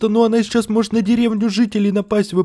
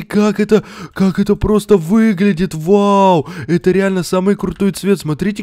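usual speaking voice

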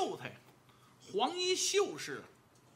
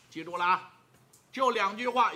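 usual speaking voice

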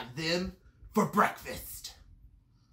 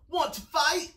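high, loud dare; pugnacious bug challenge; grouchy ladybug; 11 semitones higher, 4 dB louder than usual. A narrator's reading. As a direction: pick a fight, pitched up and loud. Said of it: "said the grouchy ladybug".

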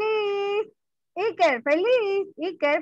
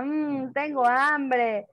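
slow, moaning hungry voice; mock hungry moan; 4 semitones lower, much slower than usual. Shown as a demonstration. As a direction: whine with hunger, drawn out. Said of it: "Su barriguita ahora".

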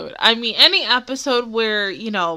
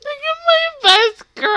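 adoring; 13 semitones higher, 5 dB louder than usual. Real, not acted. Emotional over best girl.

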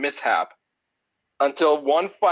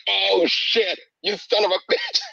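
higher-pitched cursing, acted out; mock pained shout; 7 semitones higher than usual. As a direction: curse as if toe just stubbed. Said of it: "you simply shout".

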